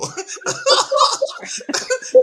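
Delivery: loud high-pitched laughter; high laugh burst. Real, not acted. Laughs at own joke.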